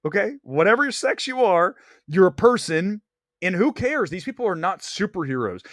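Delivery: normal talking voice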